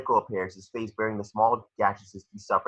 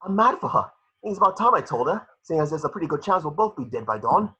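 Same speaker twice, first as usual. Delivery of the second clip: Neville, giddy; 7 semitones higher than usual. Acted. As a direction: blurt out the crush before battle. Neville confesses love.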